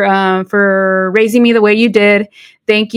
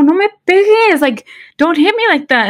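higher-pitched startled telling-off; pitched-up mock reprimand; retold scolding; 8 semitones higher than usual. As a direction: scold a child in a raised pitch, reenacted. Startled telling-off of her child, retold.

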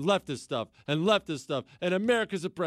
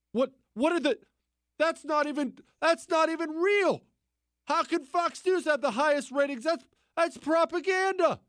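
mock outrage; 10 semitones higher than usual. Host mocks a leftist viewer shocked by Fox ratings. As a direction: play a sputtering, offended viewer.

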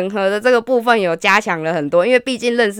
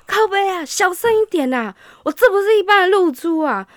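higher, hushed-urgent protest; mock flustered guy; 8 semitones higher than usual. The date hushing him, mock flustered plea. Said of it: "然后他就说".

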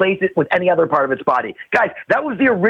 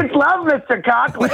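comic shout; 8 semitones higher than usual. Real, not acted. Loud comic exclamation.

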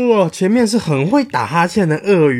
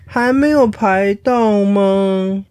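put-on very slow, higher whiny baby kangaroo; impatient crying baby; 5 semitones higher, much slower than usual. Baby kangaroo, tired of waiting in line, whining high and slow. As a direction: whine it out like a tired little kid. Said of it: "袋鼠宝宝等得不耐烦了，说".

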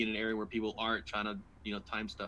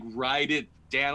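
louder, drawn-out insistence; insistent repeat; 3 semitones higher, 5 dB louder, slower than usual. Retold or imitated. Wife repeating her advice to stubborn husband, louder and insistent. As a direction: press each word harder, slowly.

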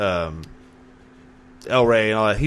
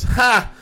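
much higher, louder single laugh; mock bark of laughter; 12 semitones higher, 4 dB louder than usual. The doorman hearing the ear-biting threat, mock laugh. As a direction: let out a sudden amused ha.

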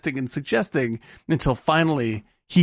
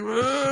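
flustered groan; 11 semitones higher than usual. Retold or imitated. Benjamin's flustered reaction.

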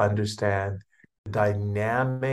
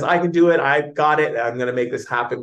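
pumped-up, higher and louder self-talk; confident inner voice; 5 semitones higher, 6 dB louder than usual. Acted. Speaker voicing energy to listeners, pumped and confident. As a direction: shout it like you just believed it. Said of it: "energy that says".

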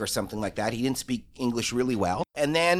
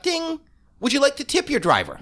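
much higher chirpy app prompt; mock app voice; 12 semitones higher than usual. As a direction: ring out the ding like a phone. Imitating app notification.

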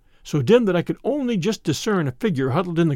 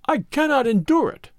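higher-pitched desperate outcry; anguished cry; 9 semitones higher than usual. A narrator's reading. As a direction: cry out that you can bear no more. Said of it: "he cried".

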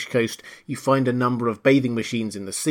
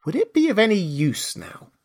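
higher, musing Alice voice; wondering Alice; 7 semitones higher than usual. A narrator's reading. Alice wonders about speaking to the mouse, musing. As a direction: lightly voice Alice thinking it over.

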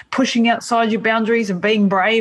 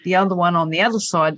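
usual speaking voice

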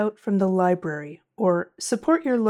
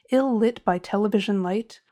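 usual speaking voice

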